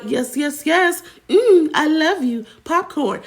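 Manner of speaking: high sing-song robotic chirp; mock AI streamer voice; NPC streamer catchphrase. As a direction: mimic the NPC live reacting to gifts. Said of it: "she making ten G's a month by".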